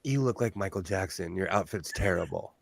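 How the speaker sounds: flatly retold insult from the cousin; low quiet deadpan jab; blunt cousin. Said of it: "my cousin was like".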